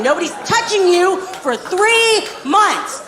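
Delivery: sarcastic dad punishing the whole world; mocking stepdad Dale. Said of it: "He's like".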